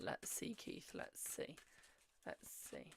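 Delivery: slow whisper under her breath; whispered muttering. Real, not acted. Reader pulling cards for Keith, slow muttered whisper. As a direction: hush it to yourself as you pull cards.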